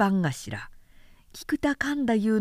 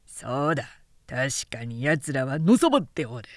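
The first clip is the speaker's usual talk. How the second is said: lower-voiced samurai agreeing with a sneer; grumbling young samurai; 7 semitones lower than usual. Second samurai backing up his friend, low and scornful. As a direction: mutter your agreement, lower.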